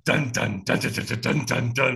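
low rhythmic vocal drumming; imitated drumbeat. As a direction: drum it out with your voice. Imitates intense African drums.

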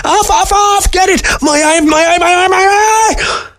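frantic, very high shriek; panicked little creature squealing; furious screeching weasel. A narrator's reading. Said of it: "His teeth were bared furiously".

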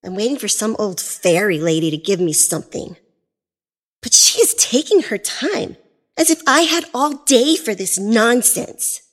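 snappy, rude brat. A narrator's reading. Spoiled girl snapping at the fairy, rude. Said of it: "Lily snapped at the woman rudely".

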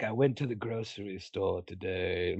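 low, soft Vincent Price drone; Vincent Price impression. Imitating a creepy horror host to his friend. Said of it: "like a young Vincent Price".

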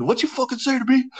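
he spins round ready to fight; enraged challenge. Retold or imitated. Member turning back, enraged.

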